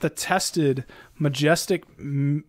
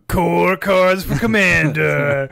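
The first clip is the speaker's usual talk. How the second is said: slow, grumbly mock German voice; 5 dB louder, slower than usual. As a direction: rumble it out like a gruff German. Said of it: "German grumbly".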